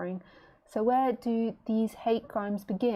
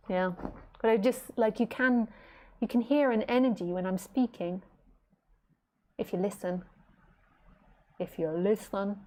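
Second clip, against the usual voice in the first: usual speaking voice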